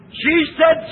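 usual speaking voice

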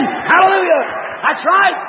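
high, lifted hallelujah; real preacher's outburst; exultant praise cry. Real, not acted. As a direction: call out in joyful agreement.

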